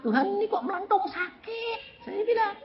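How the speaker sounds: very high, hammed-up whine; plaintive complaint. Preacher complaining to God about swelling, whining. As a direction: plead with God in a squeezed high voice.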